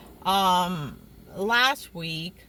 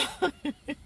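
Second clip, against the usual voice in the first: high, soft giggling; real giggle; 5 semitones higher, 5 dB quieter than usual. Real, not acted. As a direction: giggle at your own remark. Laughs at own remark.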